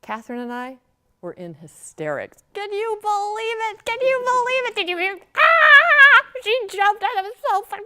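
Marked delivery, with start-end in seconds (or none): 2.5-7.8 much higher, giddy squeaky voice; giggling kid imitating a shriek; mocking glee. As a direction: mimic the scared sister with delight. Sisters laughing, mimicking Paula.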